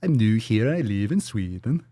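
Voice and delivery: mock Swedish accent, lilting; cartoonish sing-song Swedish accent; Swedish Chef. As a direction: read it in a hammed-up Swedish accent. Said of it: "like, the Swedish chef".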